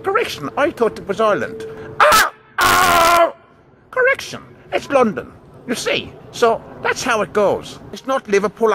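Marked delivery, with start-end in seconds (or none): 2.0-3.3 sharp loud cry of alarm; startled shout; yelp of surprise. Acted. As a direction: yelp as if caught out. Sudden yelps on realizing mistake.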